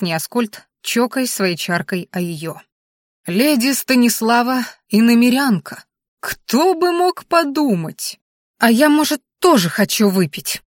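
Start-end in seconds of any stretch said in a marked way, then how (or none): 3.3-10.6 hurt, whiny, higher voice; offended. A narrator's reading. Feeling excluded from the toast, offended and whiny. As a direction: sound slighted and left out. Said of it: "обиженно произнесла".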